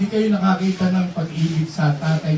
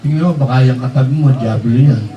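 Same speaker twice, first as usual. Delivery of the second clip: mock spooky tease; 7 semitones lower, 4 dB louder than usual. Teasing congregation about neighbor devil.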